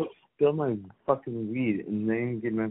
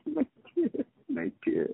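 mock crying; slower than usual. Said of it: "Are you crying right now".

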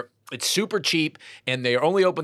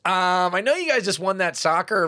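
slightly hammed-up American accent, raised pitch; 6 semitones higher, 5 dB louder than usual. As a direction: approach rowdy fans in an American accent. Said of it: "in my American accent".

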